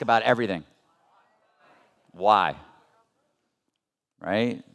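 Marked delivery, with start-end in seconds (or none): none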